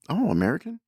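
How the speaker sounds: high, loud curious "Oh, American?"; bright surprised greeting to a stranger; surprised question. Retold or imitated. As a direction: call out a friendly surprised question.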